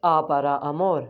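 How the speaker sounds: slow "आ para amor", vowels held open; Spanish example phrase. Shown as a demonstration. Teacher gives learners a Spanish example, slow आ para amor. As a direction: spell out आ para amor slowly. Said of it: "Spanish में बोलते हैं".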